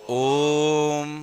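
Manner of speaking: ओम held long and soft, vowel stretched; drawn-out Om. Shown as a demonstration. Teacher demonstrating a long vowel to the class, stretched chant. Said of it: "उसे उच्चारित करके बताना मुझे".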